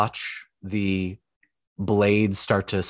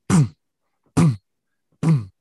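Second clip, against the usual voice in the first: short punchy booms, spaced apart; imitated hammer blows; 4 semitones higher, 6 dB louder than usual. Game master mimicking the smith hitting metal, punchy spaced booms. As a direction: boom out each hammer strike, pausing between.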